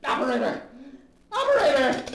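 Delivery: rising to a high, loud call; urgent calling into the phone. Acted. Leonard calls desperately for the operator, loud urgent call.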